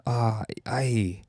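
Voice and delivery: hammed-up attempt at an Irish accent; bad Irish accent. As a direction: put on a shaky-grasp Irish brogue. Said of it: "I can't do an Irish accent".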